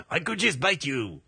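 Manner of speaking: put-on teasing French-accented tiger. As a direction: play the tiger in mock French. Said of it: "A French tiger".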